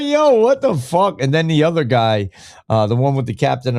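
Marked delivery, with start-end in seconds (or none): 0.0-1.2 high, stunned yo what the fuck; a high-pitched double-take at the outburst; shocked. Retold or imitated. Reacting to Patrick Byrne flipping on him, high and stunned. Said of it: "I'm like".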